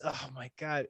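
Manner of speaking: ordinary delivery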